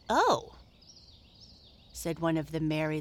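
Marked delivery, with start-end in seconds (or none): 0.1-0.6 faint disappointed. Acted. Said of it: "in a rather faint, disappointed sort of voice".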